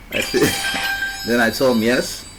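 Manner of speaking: ordinary talk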